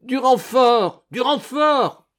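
high, loud, drawn-out cry for help; howling. A narrator's reading. The monk Garcogno calls for reinforcements mid-fight, howling. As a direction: scream for backup. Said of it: "hurla Garcogno".

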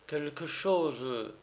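soft, slow, hesitant mumble; stammering. A narrator's reading. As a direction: trail out meekly and slowly. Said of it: "balbutia Du Mollet".

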